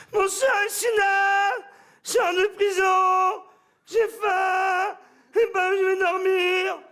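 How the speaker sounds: high, loud, slow begging drone; metro beggar spiel. Retold or imitated. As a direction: shout the spiel down the carriage.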